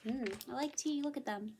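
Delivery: ordinary delivery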